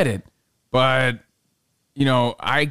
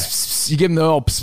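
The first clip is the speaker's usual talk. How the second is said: normal talking voice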